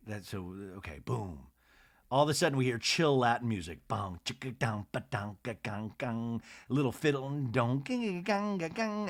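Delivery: beatbox the chill music; mock Latin music. Describing the show's music, imitating it softly.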